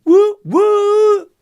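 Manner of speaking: very high, loud train whistle. Acted. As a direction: hoot like a steam train. Brighton line train.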